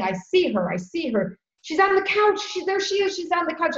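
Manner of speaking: mild imitation of bewildered fear; uncle's delusion. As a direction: voice the shaken old man seeing his late wife. Grieving uncle seeing dead wife.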